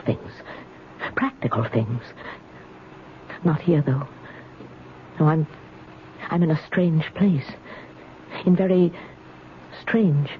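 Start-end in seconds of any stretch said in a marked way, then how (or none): none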